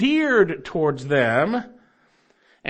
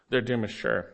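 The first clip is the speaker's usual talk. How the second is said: quiet repeat; 5 semitones lower, 5 dB quieter than usual. Real, not acted.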